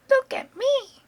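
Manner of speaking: very high, loud shout; Grandma shouting from the rooftop for attention. A narrator's reading. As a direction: yell it down from the roof. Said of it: "Grandma shouted from the rooftop".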